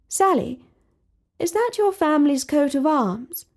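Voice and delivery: a hint of an old-fashioned royal; lightly voiced princess. A narrator's reading. Princess asking her friend about the badge, lightly voiced.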